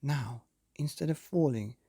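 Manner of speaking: plain reading voice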